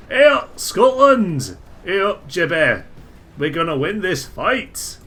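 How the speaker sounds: a lightly put-on, bad Scottish accent; terrible Scottish accent. Gamer cheering his Scottish troops in a bad Scottish accent. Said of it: "That is a terrible, terrible accent".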